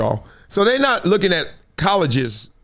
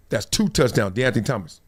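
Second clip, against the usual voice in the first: normal talking voice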